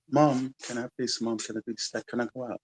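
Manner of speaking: lightly voiced child asking parents; imitating friends at home. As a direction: play a kid asking permission, lightly. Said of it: "that's how they speak".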